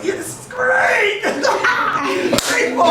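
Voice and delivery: very high, slow, laughing yell; thrilled laughing shout. Retold or imitated. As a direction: whoop with joy. Thrilled back on the field.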